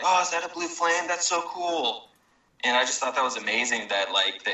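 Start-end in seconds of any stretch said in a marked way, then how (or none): 0.0-2.0 put-on high, light girl's voice. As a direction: voice a young girl, impressed. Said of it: "and she's like".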